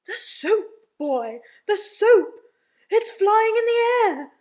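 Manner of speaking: very loud, drawn-out amazed bellow; astonished shout. Acted. Daddy seeing floating soap, astonished shouting. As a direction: holler in amazement, climbing high at the end.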